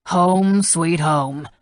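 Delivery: dry and flat, a touch loud on home. A narrator's reading. Senator sourly welcoming Ramey home, dryly. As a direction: say it with no joy at all. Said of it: "the senator said dryly".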